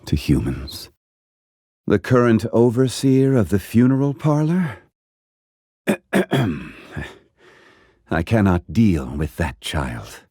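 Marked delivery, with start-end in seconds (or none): 5.9-6.6 a short, awkward throat clear; uneasy little ahem. Acted. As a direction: clear your throat to dodge the question. Awkward pause before changing topic.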